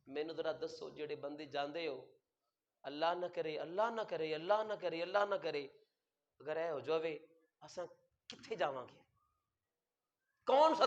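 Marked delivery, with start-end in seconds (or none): 0.1-9.0 soft, low, broken plea; a quiet trembling plea to God; pleading old man. Retold or imitated. Preacher voicing the old pilgrim, soft broken plea.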